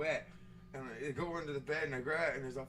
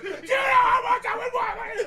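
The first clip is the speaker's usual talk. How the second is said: high, loud enraged scream; losing it; 12 dB louder than usual. Retold or imitated. Friend screaming over ruined print, losing it. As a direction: re-enact a furious, unhinged yell. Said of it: "I fucking lost my goddamn mind".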